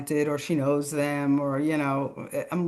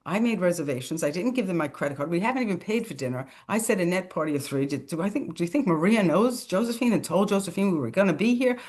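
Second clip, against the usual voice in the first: 1 racing inner thoughts; faster than usual. Retold or imitated. Inner panicked thoughts, fast.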